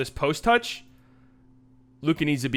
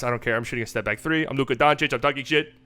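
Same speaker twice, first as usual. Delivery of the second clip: Luka Doncic; 3 semitones lower, 3 dB quieter than usual. Acted. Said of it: "He's such an asshole".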